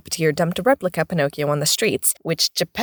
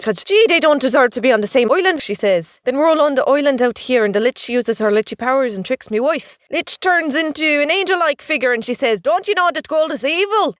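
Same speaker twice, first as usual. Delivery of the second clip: chatty Irish leprechaun voice; leprechaun storyteller; 5 semitones higher than usual. Acted. Leprechaun tells of his island, chatty Irish brogue. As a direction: spin the yarn as an Irish leprechaun.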